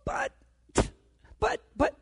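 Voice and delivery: normal talking voice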